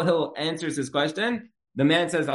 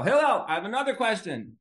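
higher, singsong pestering call; mock calling out; 7 semitones higher than usual. Man interrupting Hillel's bath, high mock call. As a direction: call out to pester, playfully acted.

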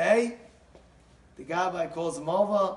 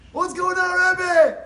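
high, very loud holler; loud bewildered outcry; exasperated demand; 11 semitones higher, 12 dB louder, much slower than usual. Retold or imitated. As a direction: shout the question at the rabbi, swelling on the last word. Husband demands answer from Rebbe.

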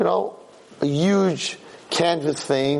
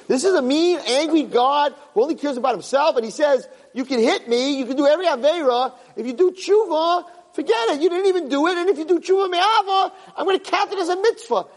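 high, animated mock tirade; incredulous retold rant; 10 semitones higher than usual. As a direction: retell it as if it were outrageous.

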